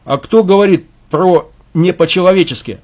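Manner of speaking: loud, sharp retort; cutting off. Acted. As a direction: snap back to cut him off. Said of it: "оборвал его Вадим".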